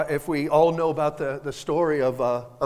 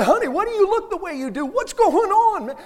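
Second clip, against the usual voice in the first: high, loud mock concern; exaggerated high-pitched questioning; imagined worried husband; 14 semitones higher, 8 dB louder than usual. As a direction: play a fretting husband, pitched way up.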